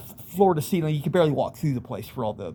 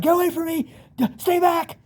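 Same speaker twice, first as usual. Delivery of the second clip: much higher, frantic cry at attackers; terrified yell; 13 semitones higher, 3 dB louder than usual. Retold or imitated. Swinging a bat at imagined demons, terrified high yell. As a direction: yell to keep monsters away in panic.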